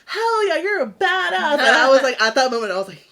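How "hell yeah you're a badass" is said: high, loud retold hype; reenacted whoop of approval, pitched way up; excited cheering. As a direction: cheer them on like an impressed friend. Acts out friends cheering her.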